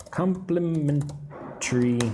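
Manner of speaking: Complimentary drawn out slowly, each syllable clear; Complimentary spoken slowly, teacher style; slow model word. Shown as a demonstration. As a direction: stretch Complimentary for learners. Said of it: "That's how I say it".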